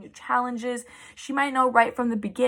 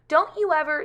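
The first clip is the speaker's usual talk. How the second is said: mild scolding, higher and louder; 6 semitones higher, 5 dB louder than usual. Acted. Missus Lane to Amelia Bedelia, cross. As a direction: start telling someone off, raised pitch.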